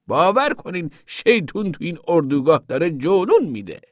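high-pitched indignant testimony; zealous moralizing woman. A narrator's reading. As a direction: build to a loud, alarmed denunciation. Voices the preachy woman. (زن مذهبی برآشفته با صدای زیر)

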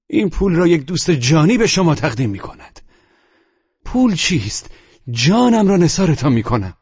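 high, fervent outburst; impassioned. A narrator's reading. Narrator offers Polina his very life, impassioned.

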